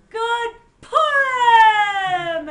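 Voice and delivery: very loud, high, drawn-out greeting; exuberant shout. A narrator's reading. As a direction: shout it and hold the last syllable. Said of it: "But Pinchas shouted".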